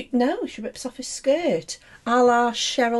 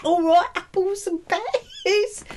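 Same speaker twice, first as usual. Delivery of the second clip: higher, drawn-out Cockney caricature; Ben's mock Cockney; 7 semitones higher, slower than usual. Claiming co-host talks like that, mock Cockney accent. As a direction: stretch the vowels in cartoon Cockney. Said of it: "I'm sure Ben talks like that".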